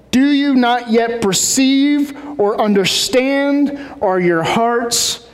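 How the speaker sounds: drawn-out, deliberate questioning; reproachful and very slow; slow, pointed rebuke. Acted. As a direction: spell out the rebuke word by word. Jesus' rebuke read with emphasis.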